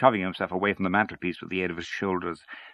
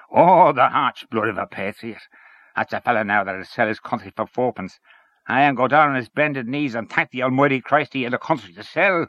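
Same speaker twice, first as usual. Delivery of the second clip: Henchy scornful; 5 semitones higher, 6 dB louder than usual. Acted. Henchy mocks patriot in story.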